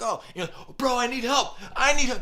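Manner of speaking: high, loud hammed-up plea; excited cry for help. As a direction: act out needing help with a big fish. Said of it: "use the words he uses".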